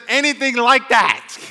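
high, loud, slowed preacher's shout; emphatic rebuttal. Real, not acted. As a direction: thunder the verse back, stretched out. Preacher thunders the verse as rebuttal.

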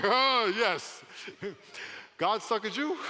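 very high, loud triumph with laughter; gleeful crowing. Retold or imitated. Pastor teasing those who doubted him, gleeful. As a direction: crow it through a laugh.

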